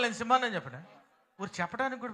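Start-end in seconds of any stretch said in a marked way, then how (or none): 0.0-0.5 loud, higher declaration; said loudly. Shown as a demonstration. Preacher urging the crowd to shout, loud. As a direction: boom it out like a lion. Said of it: "గట్టిగా చెప్పాలి".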